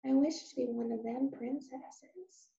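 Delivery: soft, shy wish; shyly. Acted. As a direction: confess it quietly. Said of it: "said Meg shyly".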